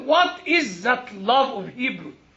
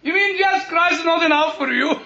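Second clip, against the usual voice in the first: higher-pitched rhetorical question from the pulpit; fervent, challenging preacher; indignant challenge; 8 semitones higher, 5 dB louder, faster than usual. Real, not acted. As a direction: confront the congregation with disbelief. Challenges congregation while preaching.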